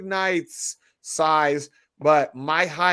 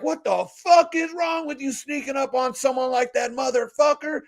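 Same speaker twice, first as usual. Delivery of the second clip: retold angry yell; 10 semitones higher than usual. Retold threat to a homeless man.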